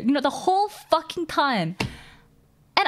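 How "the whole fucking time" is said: usual speaking voice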